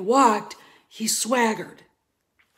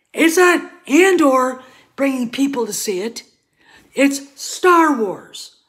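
louder and higher, Star Wars drawn out slowly; heated insistence; 4 semitones higher, 7 dB louder than usual. Real, not acted. Fan insisting to viewers what draws people in, loud and heated. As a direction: drive home that it's Star Wars. Ranting about the show.

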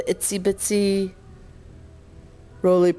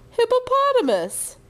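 high, playful squeak; silly guess; 13 semitones higher than usual. Real, not acted. Mother offering son a silly animal, high playful voice. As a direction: pop it out high like a silly idea.

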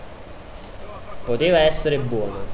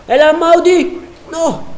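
high, drawn-out alarmed cry; excited shout; 10 dB louder, slower than usual. Real, not acted. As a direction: yell it at the screen. Sudden danger in the game. (grido concitato)

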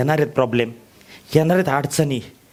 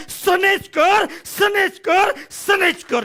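much higher, chanted call of the name; 11 semitones higher, much slower than usual. Acted. Speaker imagining his tongue crying his name, called out high. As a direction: ring it out like a rallying cry.

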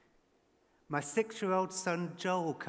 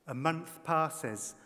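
usual speaking voice

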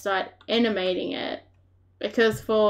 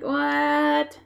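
higher, drawn-out puzzled "what"; mock confusion, pitched up; confused reaction; 6 semitones higher than usual. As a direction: act out baffled disbelief at yourself. Acts out own confused reaction.

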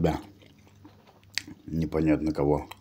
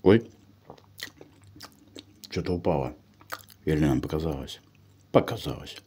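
talking around a bite; muffled chewing speech; mouth full, chewing. Real, not acted. Seen 1: Just bit bread; cheek bulges and jaw works while talking.

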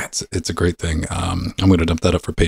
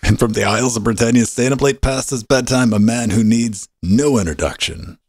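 building host build-up, slowing at the end; announcer intro; 5 semitones higher than usual. Acted. Host introduces the next cohost, announcer build-up. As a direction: hype the next guest like a ring announcer.

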